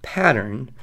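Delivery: ordinary talk, nothing marked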